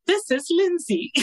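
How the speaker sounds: mock high Lindsay voice; Lindsay impression. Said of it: "see if I can do it in her voice".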